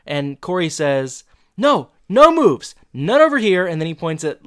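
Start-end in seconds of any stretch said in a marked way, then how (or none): 1.6-3.7 mock protesting. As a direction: deny it in hammed-up alarm. Retold emphatic denial.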